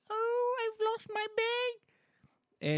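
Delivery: very high, very slow falsetto; [name]'s double voice. Acted. Streamer showing the voice to viewers, high and drawn-out. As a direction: drag it out in a high put-on voice. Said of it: "[name] Double voice".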